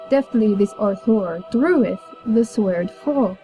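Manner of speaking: ordinary delivery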